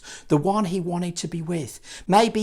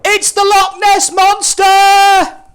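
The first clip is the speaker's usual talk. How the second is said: high, loud, slow terrified shout; scared shout; 12 dB louder, slower than usual. Acted. Frightened disciple shouts at the shadowy figure, loud and high. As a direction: yell it at the shadow on the water. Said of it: "He screamed".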